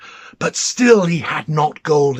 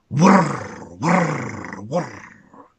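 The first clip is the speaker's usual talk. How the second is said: lightly voiced whirring wheel; much slower than usual. A narrator's reading. Narrator voices the spinning wheel, a whirring wheel. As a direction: hum the wheel turning.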